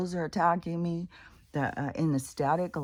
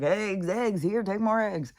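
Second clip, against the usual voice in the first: higher, mock eager hawker; eggs giveaway pitch; 7 semitones higher, 4 dB louder, slower than usual. Mocking Safeway handing out eggs, mock eager hawking. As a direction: push eggs on people in a raised imitation voice. Imitates store pushing eggs on shoppers.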